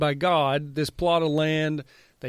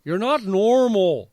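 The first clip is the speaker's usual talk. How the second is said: louder, higher, slowed scold; mock scolding; 5 semitones higher, 5 dB louder, slower than usual. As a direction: scold it like a fed-up Mountie.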